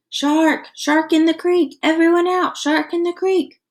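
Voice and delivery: high, loud alarm call; captain shouting a shark alarm. Acted. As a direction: warn the whole creek. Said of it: "Captain Wilson's voice shouting".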